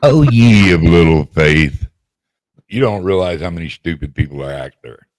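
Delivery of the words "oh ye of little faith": slow, loud mock-solemn rebuke; mock preacher. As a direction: play the solemn prophet.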